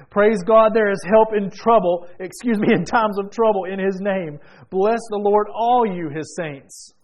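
full-force praise preaching; building preaching. Acted.

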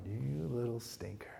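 whispered, slow; whispered scold. Retold or imitated. A dad muttering about his daughter. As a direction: breathe it like a fond rebuke. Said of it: "I thought".

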